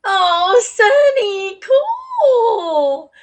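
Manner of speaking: excited. Real, not acted. Reacting to a viewer on stream.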